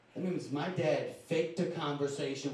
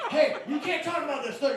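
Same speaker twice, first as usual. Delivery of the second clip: high, loud, laughing protest; shocked protest; 12 semitones higher, 7 dB louder than usual. Retold or imitated. Son protesting the dad's confession, high and laughing. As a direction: squeal out the objection through laughter.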